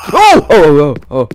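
sudden high, loud scream; real frightened yelp; startled scream. Real, not acted. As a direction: shriek at the jump scare. Jump scare.

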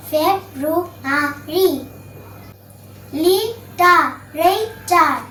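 impossible, television, gymnasium, February, literature very slowly, each in four pieces; four separated syllables. Shown as a demonstration. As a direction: pause after every syllable, as in "im - po - ssi - ble". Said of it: "তিনের অধিক syllable থাকে". (impossible থেকে literature খুব ধীরে syllable ভেঙে ভেঙে উচ্চারণ)

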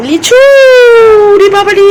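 high, loud delighted exclamation; delighted surprise. Real, not acted. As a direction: gasp with joy at the gift. Sees the lychees brought home. (খুশিতে চমকে উঁচু গলায় বলা)

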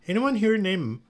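high, loud, questioning call. A narrator's reading. Guard at dressing room door seeking Maya, calling out. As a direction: call a name into a busy room.

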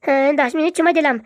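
high-pitched petulant threat; high, insistent demand; petulant ultimatum. Acted. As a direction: give a sulky ultimatum, pitched well up. Son threatens to leave home.

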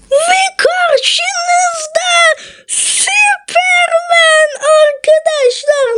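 slow, drawn-out announcer build; stretched-out announcer reveal; grand reveal. Acted. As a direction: present it like a showman. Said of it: "grand reveal to viewers".

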